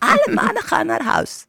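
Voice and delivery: high-pitched put-on Tukker accent; imitated Tukker voice. Co-host mimicking TV contestant sending men home, high Tukker voice. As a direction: push the mock Tukker voice up high. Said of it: "dat stemmetje nadoen".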